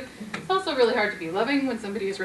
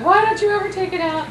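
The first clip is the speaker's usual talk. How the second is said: complaining; 9 semitones higher, 6 dB louder than usual. Shown as a demonstration. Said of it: "My complaining about".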